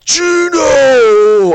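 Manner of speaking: high, very loud drawn-out stadium yell. Retold or imitated. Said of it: "I'm, like, yelling. I'm loud".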